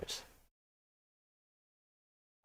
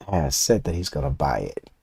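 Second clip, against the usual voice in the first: ordinary talk, nothing marked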